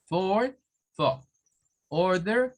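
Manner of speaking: American for, order; rhotic words. Shown as a demonstration. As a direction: sound the R in each. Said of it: "repetimos estos".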